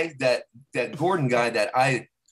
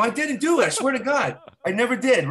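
higher-pitched retold protest; insistent mock-pleading denial; 8 semitones higher than usual. Retells denying he slept with them.

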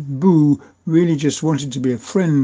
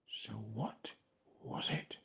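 whisper; 4 semitones lower, 21 dB quieter than usual. A narrator's reading. Suspenseful question in story.

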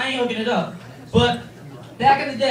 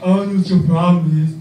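brother impression voice; 7 semitones lower, 5 dB louder than usual. Brother Jonathan gives hygiene advice, low and loud. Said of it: "He goes".

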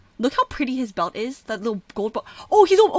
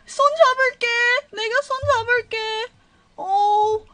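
high loud eager cries; excited fuss; 9 semitones higher, 11 dB louder, much faster than usual. Real, not acted. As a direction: rush in to comfort, excited. Rushes to hold his hand.

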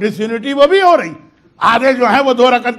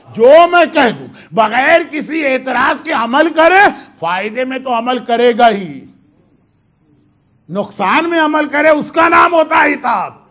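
preaching shout. Acted. Preacher voicing Mawla demanding obedience, shouting. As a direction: call it out to the whole gathering.

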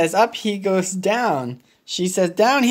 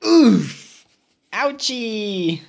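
plain reading voice

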